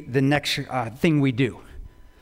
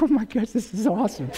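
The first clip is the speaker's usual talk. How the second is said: high, laughing joy; laughing delight; 8 semitones higher, 4 dB quieter than usual. Real, not acted. Seeing little Henry run back, laughing with joy.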